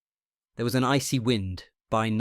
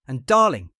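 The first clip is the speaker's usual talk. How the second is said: furious; 3 semitones higher, 10 dB louder than usual. A narrator's reading. Patient furious at name.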